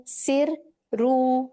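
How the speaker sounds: सिर रू split into two slow beats, the r doubled; drawn-out syllables. Shown as a demonstration. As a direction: stretch each syllable like a teacher. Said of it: "सीन रॉ जेर सिर".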